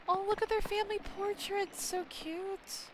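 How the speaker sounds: high, gushing coo over cute portraits; gushing delight. Real, not acted. Gamer cooing over the family portraits, high gushing delight. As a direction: melt over the cute pictures.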